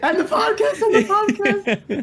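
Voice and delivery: high laughing voice. Real, not acted. Laughing through excited add-on.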